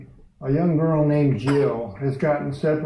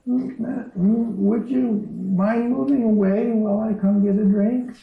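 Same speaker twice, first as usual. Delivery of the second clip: put-on high, timid girl's voice asking the lion to move; a small girl pleading with a huge lion, much higher; timid young girl; 8 semitones higher than usual. Preacher voicing Jill asking the lion to step aside, high and timid. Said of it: "she realized it was the lion speaking".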